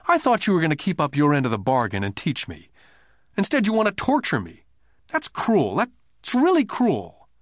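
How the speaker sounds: put-on a hurt kid pleading his case; upset boy. A narrator's reading. Boy's grievance continued.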